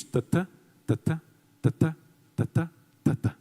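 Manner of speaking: short ta then stressed TÁ, like a heartbeat; heartbeat rhythm. Shown as a demonstration. Teacher beating out the iambic heartbeat for the class. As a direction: tap out tatá tatá in a steady pulse. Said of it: "com a batida do coração".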